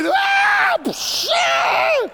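very high scream with splash noise; mock horrified scream. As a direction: imitate shrieking as water gushes out. Imitates screaming at gushing water.

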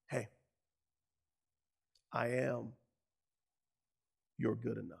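soft, low, tender answer; tender reassurance. Acted. Preacher voices Jesus answering his doubt, tender reassurance. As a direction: reassure gently, with long pauses.

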